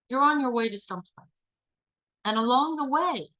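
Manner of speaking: calm facilitator voice; guided visualization. Acted. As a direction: lead the group through a daydream, gently.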